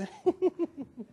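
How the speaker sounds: high, soft mock swooning titter; imitated wife's reaction. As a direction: pretend to be a flattered wife tittering. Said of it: "a wife reads and goes".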